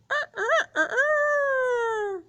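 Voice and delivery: high, loud crowing; rooster crow. Shown as a demonstration. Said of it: "What about the rooster".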